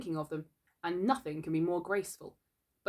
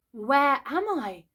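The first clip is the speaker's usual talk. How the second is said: high, slow, wondering question; mock surprise from Wendy; surprised; 7 semitones higher, 5 dB louder, much slower than usual. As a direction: act amazed. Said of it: "She looked properly surprised".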